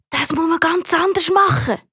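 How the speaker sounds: plain reading voice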